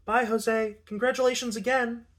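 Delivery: high, singsong farewell; cheery goodbye. A narrator's reading. Woman congratulating her friend as she leaves, cheery and high. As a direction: pitch it up into a polite send-off.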